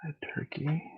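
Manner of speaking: whispered order aside; whispering. Real, not acted. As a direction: whisper it off mic. Whispering orders to someone off mic.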